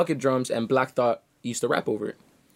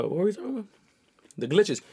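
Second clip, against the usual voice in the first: ordinary talk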